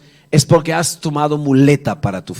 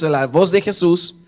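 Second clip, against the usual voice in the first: ordinary delivery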